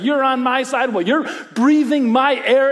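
high, loud imitation of squabbling kids; retold bickering. Dad imitating kids fighting in the back seat, mock bickering. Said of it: "And then the fight does the thing".

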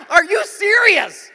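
slow, high, loud disbelief; hammed-up indignant shout; mock outrage.

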